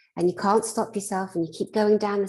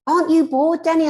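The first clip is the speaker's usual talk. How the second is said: mock exasperated snap; 10 semitones higher, 6 dB louder than usual. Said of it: "I'm like".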